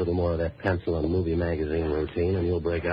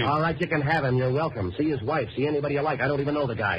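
high-pitched, impatient protest; exasperated denial; 7 semitones higher than usual. Acted. Boatman denying he knows the wanted man, exasperated. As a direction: protest it high, brushing them off. Irritated denial under pressure.

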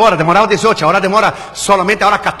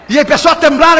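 usual speaking voice

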